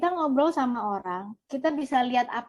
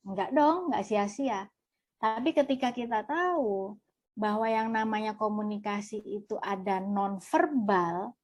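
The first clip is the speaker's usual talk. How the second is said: normal talking voice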